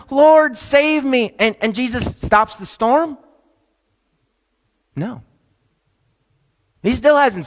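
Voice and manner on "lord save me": high, drawn-out plea of a sinking man; pleading call for help. Retold or imitated. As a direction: call out slowly for rescue. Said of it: "Peter calls out to Jesus".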